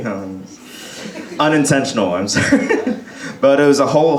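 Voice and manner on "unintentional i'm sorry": ordinary delivery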